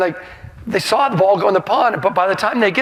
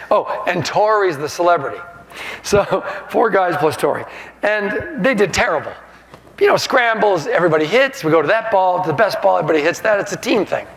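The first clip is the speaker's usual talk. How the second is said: ordinary talk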